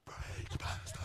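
mock whisper of wives. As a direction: hiss it like a secret thought. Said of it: "this is how they look inside".

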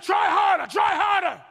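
loud, high shouted order; angry demand. Acted. Demanding partner answers the one who's trying, angry shout. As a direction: shout it, pushing hard on each repeat. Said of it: "And the person said".

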